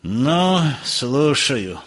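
mild distaste; squeamish disdain. A narrator's reading. Professor answers the telephone, mildly disdainful. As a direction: answer with a touch of disgust. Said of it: "брезгливо спросил Персиков".

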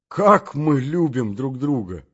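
exclaimed. Acted. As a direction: cry the words out, moved. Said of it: "закричал я". (громкое, медленное восклицание)

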